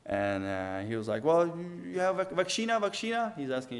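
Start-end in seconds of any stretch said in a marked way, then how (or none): 1.2-3.3 high-pitched imitation of a Romanian-speaking official; Romanian border man. Romanian man at the border asks about vaccination, imitated high. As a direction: act out the official's questioning in a higher voice. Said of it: "And he speaks Romanian".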